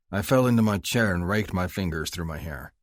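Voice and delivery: ordinary delivery, nothing marked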